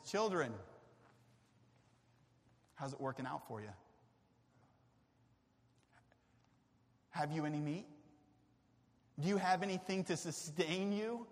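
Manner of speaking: quiet, soft and tender; gentle Jesus. Acted. Jesus calling gently from the shore, soft and tender. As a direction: ask them softly, like a loving father.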